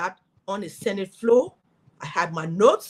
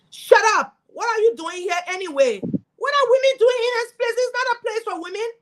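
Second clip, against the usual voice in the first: high, loud, scornful senator putting her down; mock male senator; 12 semitones higher, 5 dB louder than usual. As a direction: sneer it down at her like a dismissive colleague. Hostile male senator silencing her.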